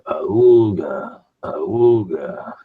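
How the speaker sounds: drawn-out horn-like "auga", swelling then falling; imitated phone alert. Imitating buddy's phone going off as he sleeps, klaxon-style. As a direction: mimic an old klaxon going off. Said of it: "his phone's going".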